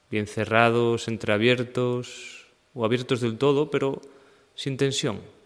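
even, unhurried meditation guide; guided meditation. Acted. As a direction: guide them through the body in level phrases with long pauses. Leads a seated meditation practice. (meditación guiada, pausada y serena)

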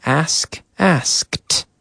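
asked ending in a crisp t sound. Shown as a demonstration. Teacher drilling the past tense for learners, crisp final t. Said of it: "we use the T sound".

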